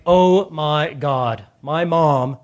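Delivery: put-on whiny, eye-rolling teen groaning at his mom; teenage son fed up with his mother; exasperated teenager. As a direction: sigh it out like a fed-up thirteen-year-old.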